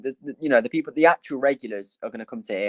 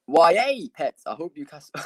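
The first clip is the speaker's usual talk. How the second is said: badly faked Geordie accent, pitched up; attempted Newcastle accent; 6 semitones higher, 5 dB louder than usual. Streamer clowning for the chat, mock Newcastle accent. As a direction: have a half-hearted go at a Newcastle accent. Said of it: "That's not my Newcastle ac".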